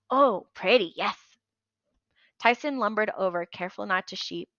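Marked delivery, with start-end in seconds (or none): 0.1-1.2 put-on childlike delight. Tyson admires fleece.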